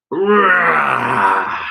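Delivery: loud throaty roar. A narrator's reading. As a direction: roar like a beast. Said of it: "a throaty roar".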